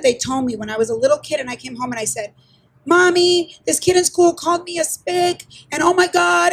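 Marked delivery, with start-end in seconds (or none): 2.8-6.5 put-on higher, mildly upset child voice; tearful child, retold. Little girl running to her mother about an insult at school, mock tearful. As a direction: play a hurt kid without real tears. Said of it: "and I was crying".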